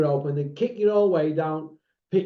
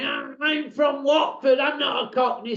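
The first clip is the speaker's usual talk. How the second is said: imitating someone's outraged objection; mock indignant protest; 8 semitones higher than usual. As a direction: shout it like an affronted complainer. Retold indignant protest from a complainer.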